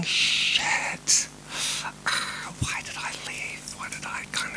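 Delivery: anguished inner whisper; whispered thoughts. Retold or imitated. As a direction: fluister de vragen aan jezelf, vol spijt. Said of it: "I remember thinking".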